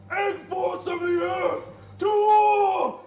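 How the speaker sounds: high, loud war cry; rally the army, shouting; battle cry. Acted. Giant headmaster rallies his terracotta army, shouting. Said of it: "he goes".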